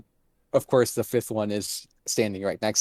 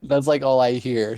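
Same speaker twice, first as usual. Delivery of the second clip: normal talking voice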